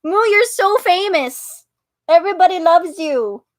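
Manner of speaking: shower the cat with praise; doting baby talk. Retold or imitated. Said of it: "You know, I love her".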